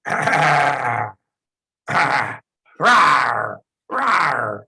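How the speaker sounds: loud growling rawr; loud mock animal growl; throaty growl. Shown as a demonstration. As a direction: growl like an angry captain showing fangs. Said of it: "make this sound in your throat".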